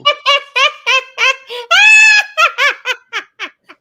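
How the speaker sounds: big high shrieking laugh; loud laugh. Real, not acted. Cackling at the homeowners joke, loud laugh. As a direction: burst out laughing loudly.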